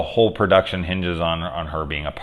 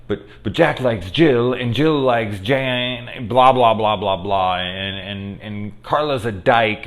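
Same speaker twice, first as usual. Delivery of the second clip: mocking film student; 4 semitones higher, slower than usual. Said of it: "it was like".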